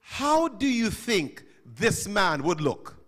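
ordinary talk

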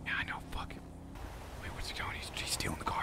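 hushed voiceless radio whisper. Acted. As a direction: whisper so he won't hear.

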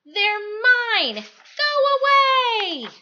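high, loud possessive snap; grumbling Pig. Acted. Pug yells at Trevor to keep away, high and loud. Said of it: "But Pig would just grumble".

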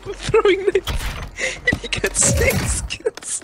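high, breathless disbelief; amazed squeak. Real, not acted. Amazed at teammate's kills.

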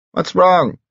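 high called-out reply; calling back. A narrator's reading. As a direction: answer from the next room. Answer called from next room.

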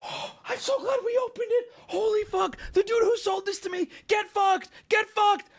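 high, worked-up triumphant cry; real overexcited triumph; gleeful gloating. Real, not acted. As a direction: crow at the seller in a squealing high voice. Gloating over the card pulled.

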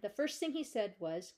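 usual speaking voice

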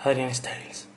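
soft, whispery perfume commercial voice; sultry perfume ad. Acted. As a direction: breathe it like a luxury fragrance ad. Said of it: "me acabo de hacer un anuncio de perfumes".

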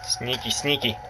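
soft, hushed sneaking voice; playful hushed whisper while sneaking; sneaky hush. Pretend. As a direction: creep up on him quietly. Sneaking up on enemy.

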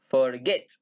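"forget" with the weight on GET; stress on GET. Shown as a demonstration. Teacher showing students the stressed second syllable, forGET. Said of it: "en la segunda sílaba".

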